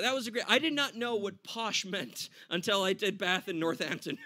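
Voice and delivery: usual speaking voice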